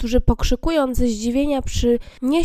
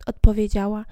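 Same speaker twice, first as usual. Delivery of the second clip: plain reading voice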